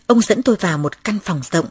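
usual speaking voice

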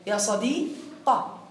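"صديقة" said slowly with a short open a on the qaf, no ya; ya sadiqa, fatha on the qaf. Shown as a demonstration. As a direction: stretch "يا صديقة" slowly with a light laugh and end on a. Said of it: "حذف الياء وفتح الحرف الأخير".

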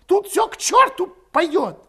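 high, loud, fuming tirade; impatient outburst. A narrator's reading. Berating hesitant comrades who won't flee, exasperated. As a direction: berate them at the top of the voice.

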